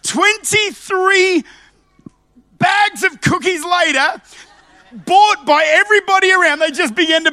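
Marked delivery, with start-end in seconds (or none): none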